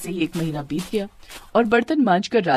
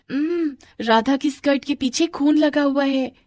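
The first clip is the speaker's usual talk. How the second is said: high sing-out to the class; acted schoolgirl jeer; taunting announcement; 7 semitones higher than usual. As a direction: point it out gleefully, pitched up. Anju announces it to tease.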